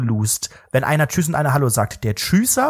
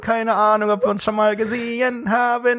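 lightly voiced awkward colleague, la la la sung off; awkward grinning colleague; 4 semitones higher than usual. Colleague in the hallway, grinning awkwardly, lightly voiced. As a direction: act out a sheepish grinning nod past a stranger. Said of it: "so nach dem Motto".

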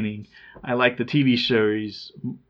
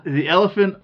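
laughing; 6 semitones higher, 4 dB louder than usual. Real, not acted. Giggling at elephant commercial.

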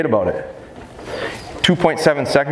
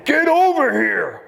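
high, drawn-out mock holler; mad hollering; 14 semitones higher, slower than usual. Imitating owners mad at dogs not coming back, hollering. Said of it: "they get mad and they start hollering".

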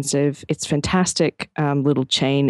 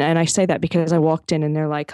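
usual speaking voice

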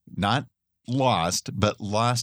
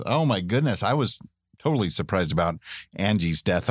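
usual speaking voice

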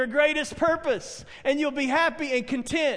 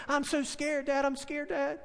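lightly voiced frightened child, softened; soft, mock-scared kid; scared little boy; 2 semitones higher, 6 dB quieter than usual. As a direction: imitate a small boy timidly telling Dad. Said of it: "this little boy".

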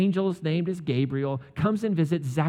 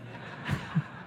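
soft breathy laugh; 8 dB quieter than usual. Real, not acted. Laughs at own joke.

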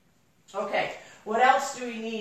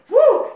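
very high, very loud "woo"; excited whoop; 13 semitones higher, 13 dB louder than usual. Real, not acted. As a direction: let out a sudden thrilled whoop. Overheated thrill while retelling.

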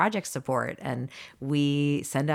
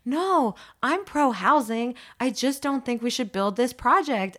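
high, loud whiny objector voice; mock opponent; 9 semitones higher, 3 dB louder than usual. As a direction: play the defensive neighbor. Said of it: "they're like".

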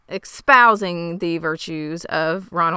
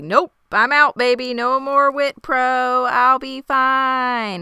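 breezy defiance; 7 semitones higher, 4 dB louder, slower than usual. Retold or imitated. Laura quitting witness protection, breezy.

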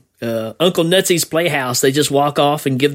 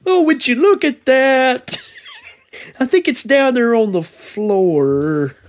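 high, slow, dazed and calm; goofy dazed comic voice; 8 semitones higher, slower than usual. Retold or imitated. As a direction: play it dopey and unbothered. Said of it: "and he's like".

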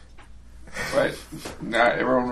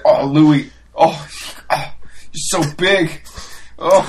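fawning admirer; 3 semitones higher, 7 dB louder, slower than usual. Retold or imitated. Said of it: "And he's like".

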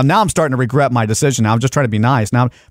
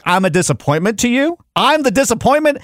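indignant disbelief; 7 semitones higher than usual. Real, not acted. Indignant at being judged.

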